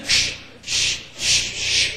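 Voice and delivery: hushed "shh, shh" of skates; unvoiced swishing skate strokes; skating noises. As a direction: imitate blades swishing on ice. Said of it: "skating around for Viagra".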